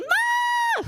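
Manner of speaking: very high, held mock shriek; answering scream. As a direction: scream back comically high, acting out the panic. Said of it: "then I started screaming".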